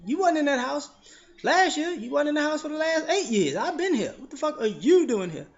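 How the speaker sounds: loud, high, indignant yell; comic yelling back at a suspicious new neighbor; angry comeback. Retold or imitated. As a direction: fire it right back at him. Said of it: "reply to the neighbor".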